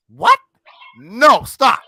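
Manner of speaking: high, loud "What?" then a loud "no, stop"; loud indignant outburst. Real, not acted. A boss refusing to be talked down to, loud and indignant. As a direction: blurt it out loud, offended and fed up.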